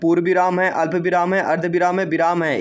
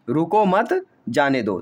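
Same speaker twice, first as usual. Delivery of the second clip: slow "रुको मत", pause, then "जाने दो"; slowly, breaking the phrase after मत; slower than usual. Shown as a demonstration. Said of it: "मान लो यहाँ लग गया". (धीरे "रुको मत", विराम, फिर "जाने दो")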